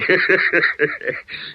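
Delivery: airy sly laugh; breathy gloating laugh. Acted. Gangster laughing to his partner, breathy. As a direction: laugh at being taken for Schaefer.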